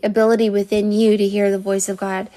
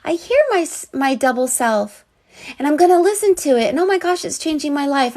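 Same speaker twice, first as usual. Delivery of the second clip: high, quick little chick voice; excited baby bird squeak; 5 semitones higher than usual. Pretend. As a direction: chirp it out like a newborn chick, thrilled. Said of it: "a little baby chick coming out going".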